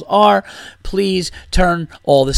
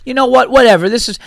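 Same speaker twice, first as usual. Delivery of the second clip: huffy heckler; 7 semitones higher, faster than usual. Retold or imitated. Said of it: "he goes".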